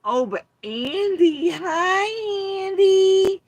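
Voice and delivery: very high, loud squealing falsetto; mock swooning. Mocking fans fawning over Andy in a shrill squeal. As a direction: squeal at a crush. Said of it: "says [name] in curiosity".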